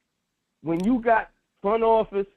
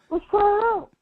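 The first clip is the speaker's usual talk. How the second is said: much higher and louder, playful echo; mock excited Mike; 12 semitones higher, 4 dB louder than usual. Cohost echoing Mike's line to his partner, amused and much higher. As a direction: toss it off like the coach's easy yes.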